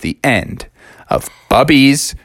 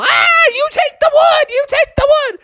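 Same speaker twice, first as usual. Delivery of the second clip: panicked cub. Acted. Bubby flees down the aisle, panicked pleading. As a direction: beg while running away.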